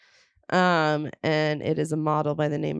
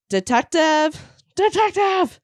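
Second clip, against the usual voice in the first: calling out higher and louder, rising on the second; calling out to detectives; 10 semitones higher, 4 dB louder than usual. Acted. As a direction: call them down the hall. Killer calling out in station.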